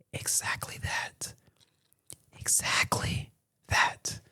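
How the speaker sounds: slow, voiceless whisper for effect; dramatic whisper. Real, not acted. Storyteller answering his own question, slow dramatic whisper. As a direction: whisper it slowly, letting each word land.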